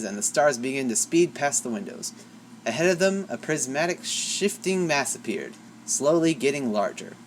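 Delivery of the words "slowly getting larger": usual speaking voice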